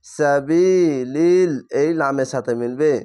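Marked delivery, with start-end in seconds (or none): 0.1-1.5 drawn-out chanted reading of তাকুলু, vowels held long; slow Quran recitation. Shown as a demonstration. Teacher demonstrating tajweed to students, slow chanted recitation. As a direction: recite তাকুলু slowly, stretching each vowel. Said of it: "এক আলিফ টেনে পড়বেন".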